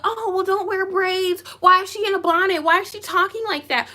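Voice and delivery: high-pitched mimicry of complainers; mocking critics. Critics picking on girls with braids, mockingly.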